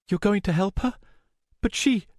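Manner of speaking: high, faltering question; anxious, incredulous voice; stunned disbelief. A narrator's reading. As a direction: ask in high-pitched astonishment. Shocked that he will help.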